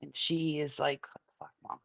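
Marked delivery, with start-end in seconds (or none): 1.1-1.8 soft mock-whisper of a fed-up teen; whispered daughter. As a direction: breathe it out like a daughter's aside.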